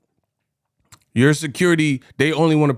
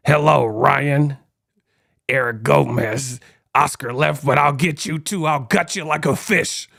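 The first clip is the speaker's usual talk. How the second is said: Ghostface voice. Retold or imitated. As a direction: purr it like a horror-movie caller. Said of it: "Ghostface in the arena".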